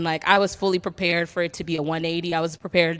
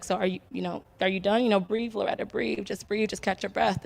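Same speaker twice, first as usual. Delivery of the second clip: calm soothing mom; 7 dB quieter than usual. Retold or imitated. Mom calming crying daughter.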